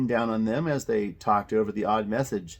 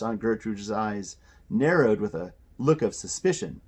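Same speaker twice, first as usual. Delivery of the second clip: usual speaking voice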